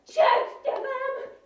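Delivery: high, loud, drawn-out scolding; angry mother's yell. Acted. Angry mother yells at boy crossing road, high shout. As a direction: yell the curse, stretching it. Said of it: "křičí na něj rozlobená matka".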